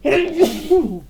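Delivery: sudden loud sneeze; sneezing. Real, not acted. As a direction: sneeze bursting out. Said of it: "I'm going to sneeze".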